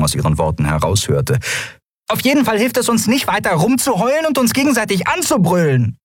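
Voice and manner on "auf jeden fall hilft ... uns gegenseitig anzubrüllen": very high little scolding voice; admonishing Nelen. Acted. Nelen tells everyone to stop shouting, high and admonishing. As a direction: admonish them in a tiny high voice.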